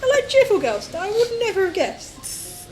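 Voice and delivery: high, giggly creepy voice; creepy pervy voice. Retold or imitated. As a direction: play it like a creepy admirer. Mock pervy character voice.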